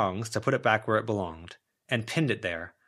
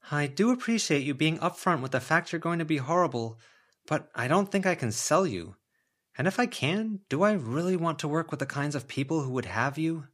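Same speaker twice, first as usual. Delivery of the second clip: wry heroine voice; 6 semitones higher than usual. Acted. Heroine talks to the mummy.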